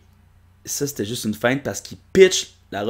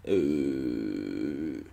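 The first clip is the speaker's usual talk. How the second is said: much lower, softer hum; low grunt; 12 semitones lower, 9 dB quieter than usual. Acted. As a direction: drop to a deep hesitant hum. Personnage hésite. (euh grave)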